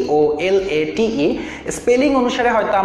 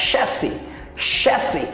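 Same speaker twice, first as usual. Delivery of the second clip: British pronunciation; 4 dB quieter, slower than usual. Shown as a demonstration. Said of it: "British উচ্চারণ".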